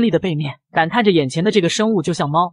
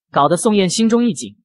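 ordinary delivery

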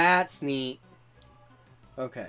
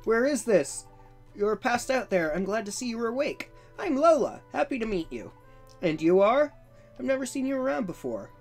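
put-on bright, higher cheerful girl voice; friendly girl voice; 6 semitones higher, 3 dB louder than usual. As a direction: greet the stranger like a sweet young girl. Reads Lola's lines in a voice.

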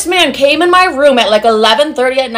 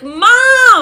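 high, drawn-out call for Mom; mock scream; 11 semitones higher, 3 dB louder than usual. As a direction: imitate a kid yelling for rescue. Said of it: "I'm screaming for my mom".

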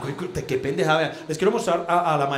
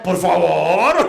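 loud, long-drawn mock plea; exasperated plea; 7 dB louder, much slower than usual. Jesus reacting to invalid baptisms, loud exasperated plea. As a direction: stretch it out in hammed-up exasperation.

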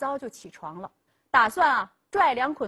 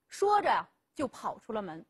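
ordinary delivery

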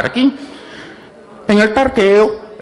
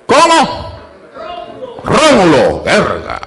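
mock deaf old man; 3 semitones higher, slower than usual. Old man answers comedian, mocked.

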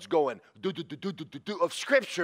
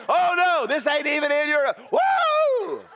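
loud high outburst ending in a laughing whoop; shouted quote breaking into a whoop; excited shout; 7 semitones higher, 4 dB louder than usual. Retold or imitated. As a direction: burst out with joy. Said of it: "and you're going".

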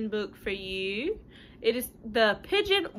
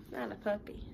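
usual speaking voice

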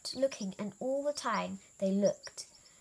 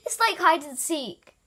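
excited; 8 semitones higher, 10 dB louder than usual. A narrator's reading. Elephant excited at the game.

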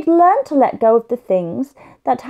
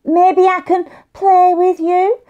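put-on higher little boy voice, hopeful and louder; high child voice; 7 semitones higher, 6 dB louder than usual. Josh asks Tom to play, high little boy voice. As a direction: ask like a small boy hoping to join in.

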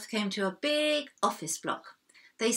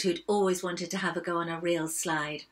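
ordinary delivery

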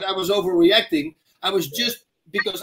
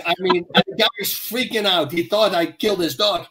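ordinary talk

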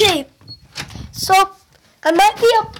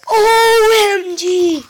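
slow, stretched, louder O M G; thrilled exclamation; 4 semitones higher, 4 dB louder, much slower than usual. Real, not acted. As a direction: draw each letter out in delight. Discovers the best thing.

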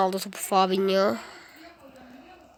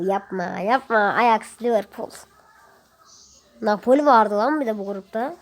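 normal talking voice

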